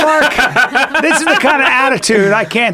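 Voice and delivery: mock indignation. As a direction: play-act being offended by the teasing. Mock outrage at Mark's joke.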